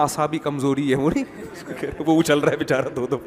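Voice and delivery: usual speaking voice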